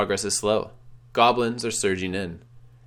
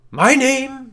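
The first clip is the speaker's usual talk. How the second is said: high, loud solemn intonation; a wraith intones his own name; intoning; 10 dB louder than usual. Acted. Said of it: "the figure intones".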